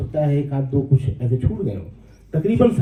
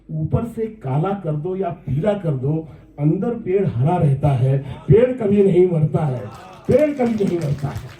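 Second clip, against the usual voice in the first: measured verse climbing into a higher final refrain; poem recitation; 3 semitones higher than usual. Acted. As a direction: build the pitch to the closing line. (चढ़ते सुर में नज़्म का अंत)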